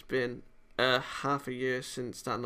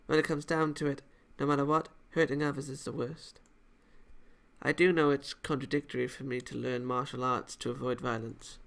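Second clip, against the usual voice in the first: ordinary talk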